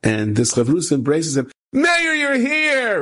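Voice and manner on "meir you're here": high, long drawn-out exclamation; overjoyed greeting. Retold or imitated. Friend embracing his study partner in Eretz Yisrael, overjoyed greeting. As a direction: stretch it out in mock-delighted greeting.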